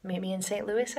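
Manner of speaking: normal talking voice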